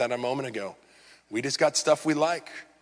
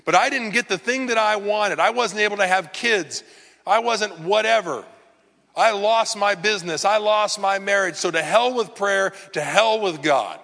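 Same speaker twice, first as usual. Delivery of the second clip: bitter complaint; 6 semitones higher, 3 dB louder than usual. Pretend. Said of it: "Maybe it's you".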